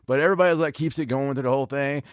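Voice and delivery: usual speaking voice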